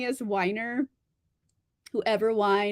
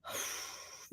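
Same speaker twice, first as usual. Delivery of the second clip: exasperated drawn-out sigh; 2 semitones lower, 7 dB quieter, much slower than usual. Retold or imitated. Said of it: "And I'm like".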